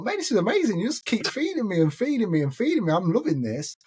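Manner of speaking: very high, excited gush; delighted gushing. Retold or imitated. Passenger thrilled at being fed nonstop, delighted gushing.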